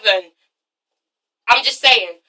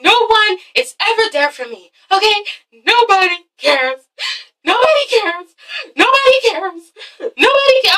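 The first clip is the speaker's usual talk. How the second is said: mock crying; 7 semitones higher than usual. Reviewer imitating a tearful mother saying nobody cares, mock crying. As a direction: imitate a self-pitying cry, climbing higher with each repeat. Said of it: "starts, like, crying".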